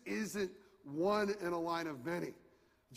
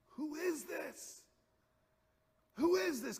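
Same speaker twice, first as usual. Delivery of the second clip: high, slow, hushed wonder; stunned by who he is; awestruck; 11 semitones higher, slower than usual. Retold or imitated. Frightened disciples ask about Jesus in high awe. Awed disciples after the calm.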